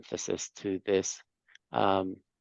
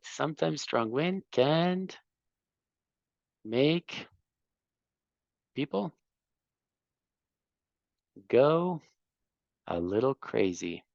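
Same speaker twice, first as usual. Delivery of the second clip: pitched higher, drawn out with long pauses between words; praising the student by echoing his answer; slow model repeat; 4 semitones higher than usual. Shown as a demonstration. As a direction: read it back to the class word by word. Teacher repeats student's answer slowly.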